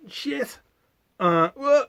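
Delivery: high, sudden startled swear; startled curse. Acted. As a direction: jump in fright and curse. Sudden fright from behind.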